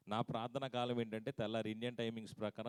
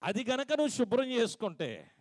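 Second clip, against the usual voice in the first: high, loud preached line; loud preaching; 12 semitones higher, 8 dB louder than usual. Acted. Pastor urging the congregation to cleanse their tongue, loud. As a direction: call it out to the whole church. Loud take then soft repeat.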